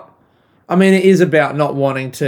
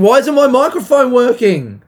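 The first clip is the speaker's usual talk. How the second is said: high, loud annoyed outburst; exasperated; 9 semitones higher, 5 dB louder than usual. Real, not acted. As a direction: complain loudly, pitch shooting up. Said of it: "it's so annoying".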